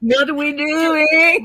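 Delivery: high, slow wailing ghost. Acted. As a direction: moan it like a ghost.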